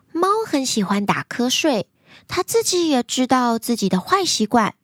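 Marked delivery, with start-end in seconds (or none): none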